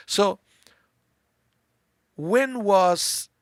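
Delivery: usual speaking voice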